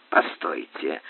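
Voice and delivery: low, soft interjection; intervening. A narrator's reading. Man interrupting the doctor and captain's quarrel, quietly. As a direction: step in quietly, pitched well down. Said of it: "вмешался Тиррель".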